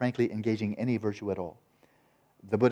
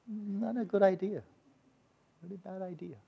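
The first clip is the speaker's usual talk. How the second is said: high mock warning; 10 semitones higher, 5 dB quieter than usual. Real, not acted. Warning about anger at bodhisattva.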